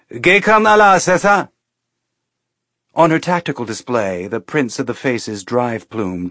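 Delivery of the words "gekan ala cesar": put-on higher, louder Belter Ceres accent; Ceres station accent. A narrator's reading. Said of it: "The accent was pure Ceres station".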